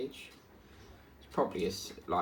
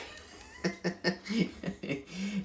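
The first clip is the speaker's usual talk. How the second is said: real laugh; 7 semitones higher than usual. Real, not acted. Laughs after story.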